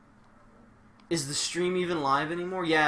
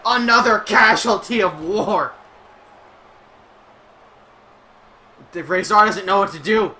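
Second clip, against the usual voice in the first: higher, louder announcer call; wrestling play-by-play; 6 semitones higher, 12 dB louder than usual. Acted. Streamer calling the match for the stream, excited play-by-play. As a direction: sell every move like a ringside announcer.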